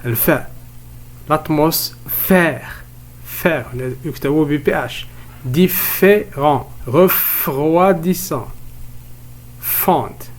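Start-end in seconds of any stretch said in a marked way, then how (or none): 1.3-2.8 "L'atmosphère" split slowly before a stressed "phère"; isolating the F sound. Shown as a demonstration. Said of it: "الصوت الذي يتكرر هو صوت". 5.4-8.5 "Différent" and "Refroidissant" slowed, the F syllable set apart; bringing out F. Shown as a demonstration. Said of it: "الصوت الذي يتكرر هو صوت".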